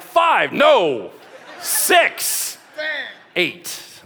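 loud, high blurted guesses, easing off at the end; mock confused guessing. Preacher mimicking the crowd guessing a hexagon's sides, mock confused shouting. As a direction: shout out wrong answers in comic confusion. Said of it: "we're like".

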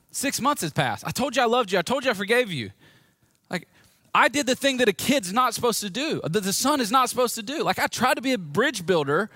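high, pressing retold grievance; indignant protest. Son protesting that he already forgave his dad, high indignant protest.

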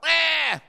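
put-on loud, high baby wail; short, loud bleating wail; mock baby cry. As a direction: imitate a baby bawling. Imitating a baby crying for care.

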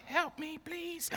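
tiny, very high, soft squeak; pleading plant. Acted. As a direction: squeak a feeble mock plea as a drooping plant. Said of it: "it's looking at me like".

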